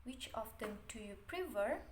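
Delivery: ordinary talk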